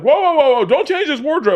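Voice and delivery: very high, loud, frantic protest; hammed-up panicked holler; acted shouted objection. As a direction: yell to halt everything across a film set. Someone yells objection across the set.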